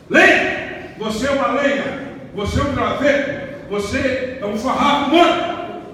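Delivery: fiery preaching. Acted. Preaching rebuke.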